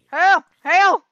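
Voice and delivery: two loud, sharp yells; sudden outburst mid-game; alarmed yelp. Real, not acted. Sudden alarm while playing.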